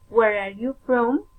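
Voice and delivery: usual speaking voice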